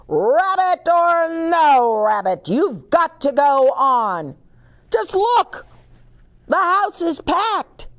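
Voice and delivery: loud, high insistence; pushy theater manager. Acted. Mister Popovich urges Milo to go on. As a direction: push Milo onstage, no excuses.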